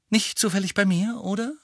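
high, pointed sarcastic question. A narrator's reading. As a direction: needle them with mock innocence. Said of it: "meinte Harry sarkastisch". (sarkastisch nachgefragt)

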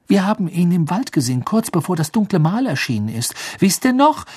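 put-on high, urgent girl's voice; eager reminder. Hermine asks Harry and Ron to remember, urgent.

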